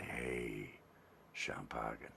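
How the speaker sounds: hushed coaxing hint; sly whispered nudge; whispered suggestion. Shown as a demonstration. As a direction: plant the word softly in a whisper. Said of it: "and you say".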